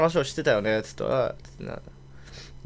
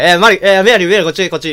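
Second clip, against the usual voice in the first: loud call, a little higher; 4 semitones higher, 10 dB louder, faster than usual. Retold or imitated. Noble loudly calls Mary over in Japanese. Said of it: "みたいな".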